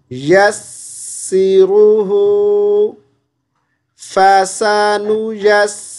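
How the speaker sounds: slow melodic tilawat chant; Quran recitation. Shown as a demonstration. Teacher recites the verse for the student, slow tilawat. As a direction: recite it slowly, drawing out each vowel.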